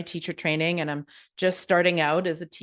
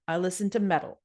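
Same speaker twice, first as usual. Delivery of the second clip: ordinary talk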